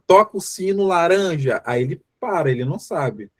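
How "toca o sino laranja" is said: raised-pitch instruction at normal pace; acted instruction. Therapist instructing a patient who struggles with colours, acted instruction. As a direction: give the patient a simple command, pitched up.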